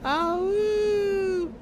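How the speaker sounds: high wolf howl; howling like a wolf pup. Pretend. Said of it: "howl like a wolf".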